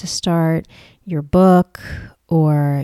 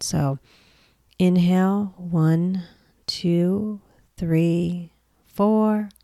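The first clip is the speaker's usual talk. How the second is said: softer, unhurried meditation voice with long pauses; guided meditation; 5 dB quieter than usual. Acted. Mentor leading listeners into a creativity meditation, guided meditation voice. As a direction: guide them gently through breath and stillness, leaving space between phrases.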